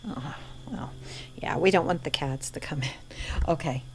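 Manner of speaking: hushed, quick whispered agreement. Real, not acted. Answering Tori about the cats, in a whisper. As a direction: whisper back so as not to make a fuss.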